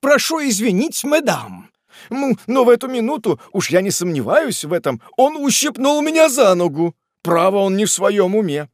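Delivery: high, agitated protest; flustered complaint. Acted. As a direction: complain in loud alarm after a pinch. Pinched man jumps up, complains.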